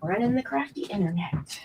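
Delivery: hushed joking aside; whispering. Acted. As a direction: whisper it with a grin. Whispered joke.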